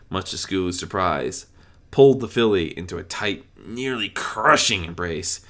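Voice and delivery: plain reading voice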